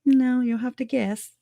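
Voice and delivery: high, taunting mock refusal; a light impression of a smug prisoner; mocking Lori. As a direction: sing it up high like a smug tease. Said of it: "and she's like".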